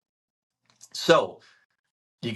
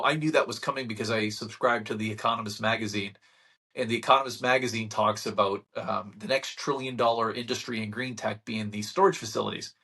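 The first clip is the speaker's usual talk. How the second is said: normal talking voice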